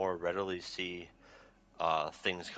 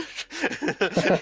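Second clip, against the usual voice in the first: high, loud cackling laugh; real burst of helpless laughter; 12 semitones higher, 8 dB louder than usual. Real, not acted. Reviewer cracking up at the plot, laughing hard.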